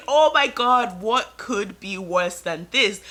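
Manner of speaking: loud, emphatic re-enacted panicked thought; retold panic. Bride recalling her wedding-day crisis, in retold panic. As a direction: act out panicking, loud then drawn out. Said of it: "I'm panicking, thinking".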